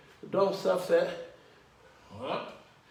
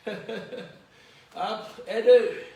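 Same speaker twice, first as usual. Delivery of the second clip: shouted count; 4 semitones higher, 7 dB louder, much slower than usual. Real, not acted. Coach counting with laugh.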